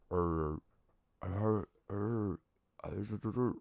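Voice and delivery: muffled, wordless "urr" murmurs; muffled voice noises. Acted. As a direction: imitate muffled talk through a door, quietly. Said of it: "like, muffled voices".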